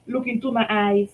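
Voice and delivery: ordinary delivery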